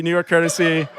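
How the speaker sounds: ordinary delivery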